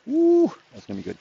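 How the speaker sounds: high, delighted ooh. Real, not acted. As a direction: coo with delight over the find. Delighted at finding garlic.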